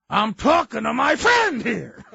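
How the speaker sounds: loud, slow, high protest; indignant shout. Retold or imitated. Husband shouts back at his nagging wife, slow and indignant. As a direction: snap back, defending his pride.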